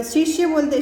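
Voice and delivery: normal talking voice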